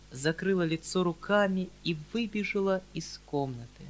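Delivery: usual speaking voice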